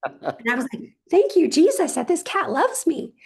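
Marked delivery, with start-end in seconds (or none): 1.1-3.1 high, loud mock praise; hammed-up joyful relief, pitched way up; exultant relief. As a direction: cry out thanks as if saved. Acts out own exultant relief.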